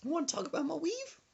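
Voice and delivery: high, menacing grandma. Retold or imitated. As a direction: warn him as granny. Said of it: "She said".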